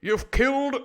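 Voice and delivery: high, booming accusation; defiant sailor. Acted. Sailor accusing a killer, loudly outraged. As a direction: throw the charge out loud and high.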